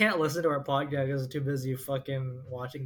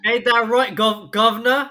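hammed-up British accent, high and loud through laughter; laughing, put on a cheesy British accent; mock British accent; 8 semitones higher, 10 dB louder than usual. Podcaster teasing co-host in hammed-up British accent. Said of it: "As the Brits do".

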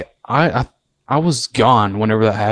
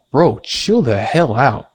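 slow dismissive; much slower than usual. Retold or imitated. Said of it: "We're like".

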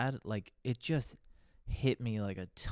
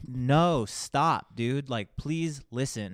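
loud, urgent plea to stop and listen; cop urging loudly; 3 semitones higher, 9 dB louder, much slower than usual. Retold or imitated. Officer pleading loudly with a cocky caller to listen. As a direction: make him stop and hear you out.

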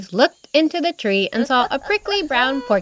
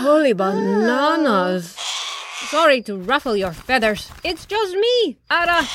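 macaw voice; 3 semitones higher than usual. Acted. Macaw startles campers.

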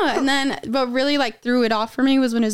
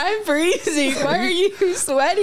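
incredulous; 6 semitones higher, slower than usual. Retold or imitated. Quoting herself confronting him.

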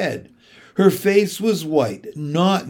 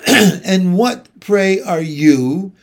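loud, haughty demand, slowing at the end; imperious questioning; 4 semitones higher, 6 dB louder, slower than usual. A narrator's reading. Great lady questioning Edmund on sight, imperious questioning. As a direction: question a stranger as a haughty queen would. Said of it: "looking hard at Edmund".

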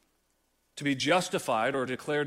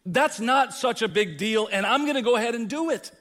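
loud, dismissive shrug at God's law; defiant sinner quote; 5 semitones higher, 5 dB louder than usual. Retold or imitated. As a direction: brush off the law like it's nothing. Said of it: "Every one of us has says".

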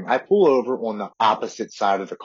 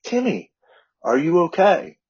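much higher voice, startled concern; startled father; 9 semitones higher, 3 dB louder than usual. Retold or imitated. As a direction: cry out the name in shock, then ask if he's okay. Friend's father recognizes him, shocked.

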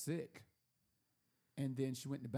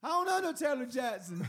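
high, loud hollered denial; yelling through door; 9 semitones higher, 6 dB louder than usual. Retold or imitated. Paranoid host rejecting the visitor, high retold yell. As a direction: yell it high, laughter creeping in. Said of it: "yelling through the door".

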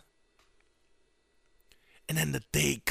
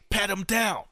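loud, raised-pitch command; higher-up's order; 7 semitones higher, 6 dB louder than usual. Acted. Secret Service boss ordering a search, loud command. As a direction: call out an order to the guards. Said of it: "maybe the higher ups, is like".

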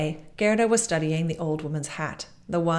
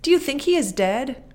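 put-on higher and louder, urgent girl's cry; a startled girl realizing time has slipped away; alarmed cry of a girl searching for her friend; 9 semitones higher, 6 dB louder than usual. A narrator's reading. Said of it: "she asked the roses".

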